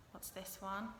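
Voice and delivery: ordinary talk, nothing marked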